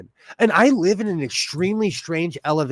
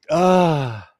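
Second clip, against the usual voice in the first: ordinary delivery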